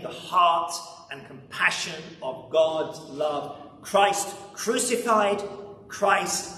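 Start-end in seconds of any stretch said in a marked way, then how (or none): 3.8-6.5 slow, measured closing litany. Real, not acted. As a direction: let each title of Christ ring slowly.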